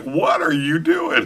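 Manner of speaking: high, slow exasperated question; exasperated dad. Retold or imitated. Dad fed up with son's fears, slow exasperated. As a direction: drag it out, fed up. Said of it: "and I'm like".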